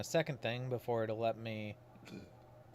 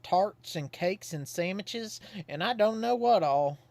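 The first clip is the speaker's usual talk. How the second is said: put-on folksy old Godfrey; 6 semitones higher, 4 dB louder than usual. Godfrey calls at Susie's house, folksy and higher. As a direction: play him as a simple, cheerful Southern townsman.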